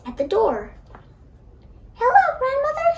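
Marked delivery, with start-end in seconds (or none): 1.9-2.9 put-on little girl calling out, much higher; called out. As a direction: sing out the greeting at the door. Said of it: "she called".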